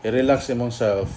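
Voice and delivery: ordinary talk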